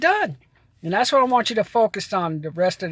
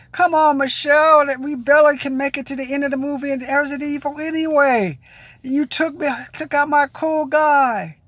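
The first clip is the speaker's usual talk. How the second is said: mock outrage; 6 semitones higher than usual. Real, not acted. Complaining to Michelle Rodriguez character.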